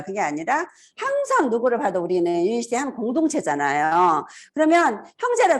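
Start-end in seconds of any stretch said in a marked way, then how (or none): none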